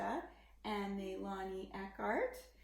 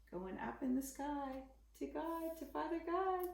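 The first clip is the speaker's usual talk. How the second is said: higher, bright excited wrap-up; 7 semitones higher than usual. Real, not acted. Asking the child about the ending, excited.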